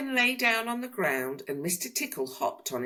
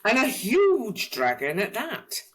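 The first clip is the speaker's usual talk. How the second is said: ordinary delivery, nothing marked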